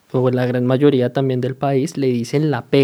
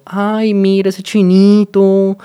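slow, drawn-out rich-kid imitation, higher and louder; mock the snobby upper-class kids; imitating a rich Bogotá teen; posh rich kid; 5 semitones higher, 4 dB louder, slower than usual. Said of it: "ellos hablan como".

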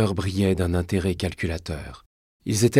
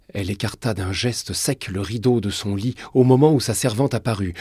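usual speaking voice

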